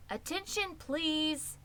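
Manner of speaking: pitched high, firm announcement; loud, firm. Acted. Pig calling to the whole barn, loud and firm. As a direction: call the barn to order, firmly. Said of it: "he said in a loud, firm voice".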